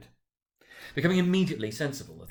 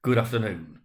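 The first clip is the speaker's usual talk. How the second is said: curt dismissal; 5 dB louder than usual. A narrator's reading. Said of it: "said Scrooge".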